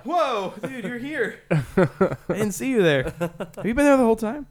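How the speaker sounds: high, dazed stoner voice; stoner impression. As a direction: play a spaced-out stoner. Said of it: "that was a good stoner impression".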